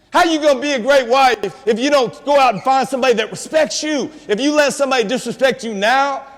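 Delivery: usual speaking voice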